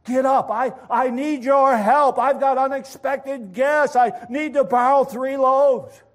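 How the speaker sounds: loud, high urgent demand; acted urgent shouting; insistent. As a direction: holler your plea through a shut door. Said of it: "And Levi knocks harder".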